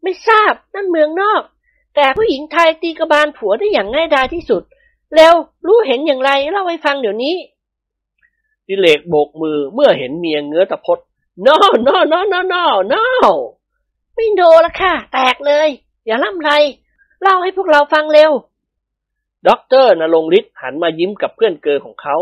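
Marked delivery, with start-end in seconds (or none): 0.0-7.3 very high feminine rebuke; scolding wife. A narrator's reading. Prapha orders her husband to talk, scolding. 11.4-13.0 high, repeated cries of no; alarmed refusal. A narrator's reading. Dilek sees his wife's raised club, alarmed. 14.1-18.3 high, insistent female command; threatening wife. A narrator's reading. Wife rejects Dilek's protests, demanding and threatening.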